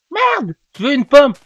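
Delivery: high, loud yell of frustration; frustrated yelling. Real, not acted. Gamer yelling into his mic for an apple, frustrated shout. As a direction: blow up over a missing apple. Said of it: "faudrait que ça arrête de crier dans mon micro".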